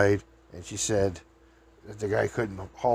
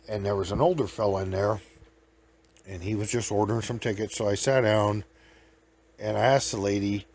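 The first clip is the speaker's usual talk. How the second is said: usual speaking voice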